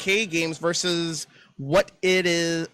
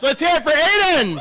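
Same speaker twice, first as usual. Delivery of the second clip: hype call-out; 8 semitones higher, 4 dB louder than usual. Real, not acted. Host calls for crowd applause.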